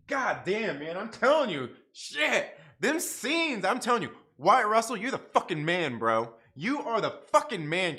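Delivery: loud, high, fired-up praise; excited raving. Real, not acted. Fan raving about Wyatt Russell's scenes, loud and excited. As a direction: shout it like you can't contain yourself.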